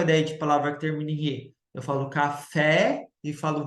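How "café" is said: "café" ending on an open, strong É; open stressed E. Shown as a demonstration. Teacher contrasting accent marks, "café" with open final É. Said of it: "ele fica agudo".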